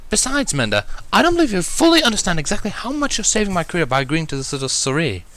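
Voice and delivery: high refined lady voice; pleading with growing fervor; Rarity, earnest. Acted.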